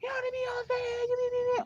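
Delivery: very high, fast mock teacher voice. Teacher catching the couple in the hall, imitated in a very high fast squeak. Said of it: "a teacher came around the corner and said".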